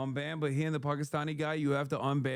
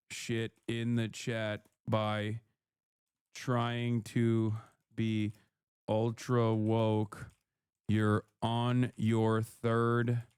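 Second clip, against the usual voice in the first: lower, very slow, each word spaced out; slow, deliberate warning; 5 semitones lower, much slower than usual. Real, not acted. Streamer warns a chatter on his third strike, very slow. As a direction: spell it out slowly like a final warning. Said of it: "slow, deliberate warning to chatter".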